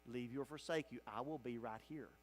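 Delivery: whisper-close reassurance of love; tender promise. Acted. Jesus' promise quoted.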